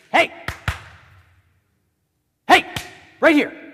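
sharp, loud, high attention-grab; tongue lashing. Shown as a demonstration. Preacher demonstrates scolding someone who must understand, loud and sharp. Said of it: "that strong tongue lashing".

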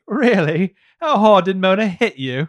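loud, high chav English; chav English accent. Acted. Evil twin mocking her sister, chav English accent. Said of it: "It's not just the accent".

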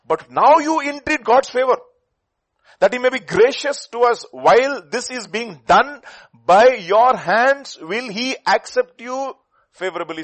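loud, high, very slow reading; preaching at full force. Acted. Preacher reading God's indictment to the church, booming. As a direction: pound out each word of the indictment.

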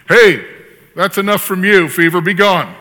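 loud, hammed-up banishing shout; mock rebuke. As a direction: parent sternly ordering a fever out. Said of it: "he rebuked the fever".

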